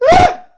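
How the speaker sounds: piercing, very high, very loud raw yell; furious scream. Real, not acted. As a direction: let out one wild scream. Peak of rant, lone cry.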